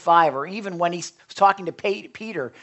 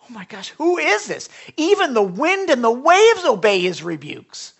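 awed amazement; 6 semitones higher, 7 dB louder than usual. Retold or imitated. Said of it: "And they're saying".

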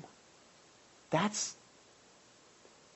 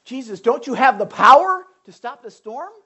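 panicked disciples; 6 semitones higher, 11 dB louder than usual. Retold or imitated. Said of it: "they didn't say".

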